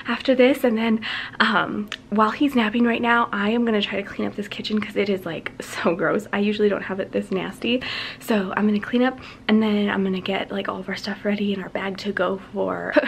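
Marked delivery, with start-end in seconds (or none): 4.0-7.8 whispering. Real, not acted. Baby napping, filming quietly. 9.5-12.9 whispering. Real, not acted. Baby napping, filming quietly.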